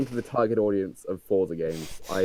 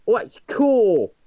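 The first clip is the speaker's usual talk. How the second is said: high, slow rough London slang; chav accent; 12 semitones higher, 7 dB louder, much slower than usual. Retold or imitated. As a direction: drag out cool like a chav. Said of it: "exposed your terrible chav accent".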